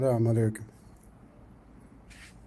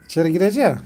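loud, higher calls to the cat; calling loudly; 5 semitones higher, 9 dB louder than usual. Real, not acted. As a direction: call the stubborn cat over, loud.

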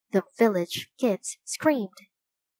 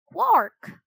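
high, louder raven squawk; croak; 9 semitones higher, 4 dB louder than usual. A narrator's reading. Raven flapping to lift off: loud squawk. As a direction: squawk as he takes off. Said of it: "he croaked".

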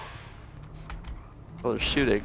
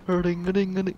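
ordinary talk, nothing marked

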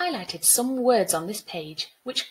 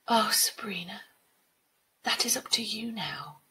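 gentle, hushed. Acted. Water spirit gently answering the girl, hushed tender voice. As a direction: answer tenderly, almost in a whisper.

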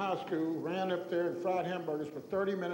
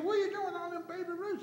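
high-pitched, imitated fatherly alarm; alarmed scolding; 11 semitones higher than usual. Father catching his boy with the candy, alarmed scolding. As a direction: pitch it way up, demanding an explanation.

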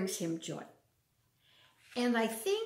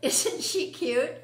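louder, higher, cooing over a cute puppy photo; gushing over puppy; 4 semitones higher, 6 dB louder, slower than usual. Real, not acted. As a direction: coo at the picture, delighted. Showing puppy photo to children.

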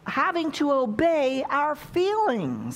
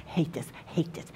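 low, hushed mock griping; imitated grumbling; 6 semitones lower, 8 dB quieter than usual. Preacher mimicking his congregation's reaction, low grumbling. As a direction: grumble it under the breath, half-whispered. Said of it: "you're all like".

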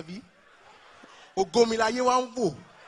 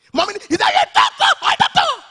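very high, loud sung-out scolding; hammed-up mother's shouted rant; mock mother ranting; 10 dB louder than usual. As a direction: rant like a furious mom. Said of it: "about to disown me".

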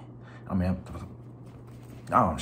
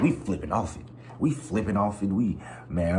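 normal talking voice

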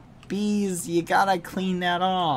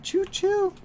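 train whistle. Pretend. Imitates a train.

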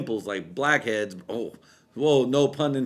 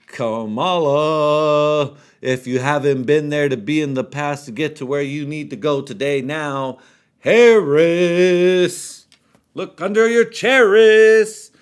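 loud, much higher, slow drawn-out hype; booming stretched announcer voice; game-show announcer; 5 semitones higher, 10 dB louder, slower than usual. Acted. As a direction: announce her like a show host.

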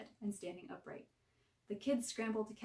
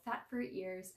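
ordinary delivery, nothing marked

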